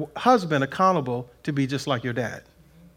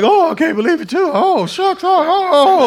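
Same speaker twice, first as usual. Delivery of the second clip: loud, high-pitched hammed-up wailing; mock weeping; 12 semitones higher, 8 dB louder than usual. Preacher imitating an emotional wife breaking down, mock weeping. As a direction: imitate someone breaking down in sobs. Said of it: "oh, weeping and breaking down".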